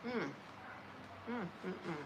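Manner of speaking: chewing while humming mm; mouth full. Real, not acted. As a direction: mm sounds through chewing fruit. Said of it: "I got fruit in my mouth".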